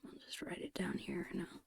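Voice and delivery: ordinary talk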